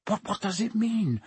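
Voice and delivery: put-on high, hesitant boy's question; stammering. A narrator's reading. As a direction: stumble on the first word, puzzled. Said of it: "he stammered".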